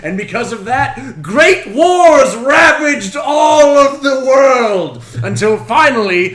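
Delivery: booming epic storyteller, slow and loud; epic narration. Acted. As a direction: build to a grand shout. Dramatic story spine, building.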